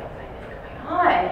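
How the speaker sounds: high, drawn-out bewildered question; puzzled residents. Acted. Other residents react to the shouting, bewildered. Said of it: "the other residents are like".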